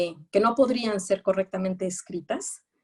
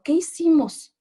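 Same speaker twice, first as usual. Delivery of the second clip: put-on high, loud, trembling-kid question; mock frightened question; 8 semitones higher, 7 dB louder than usual. Lecturer acting out the scared girl, high and loud. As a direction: voice a scared child asking. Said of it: "y yo temblando".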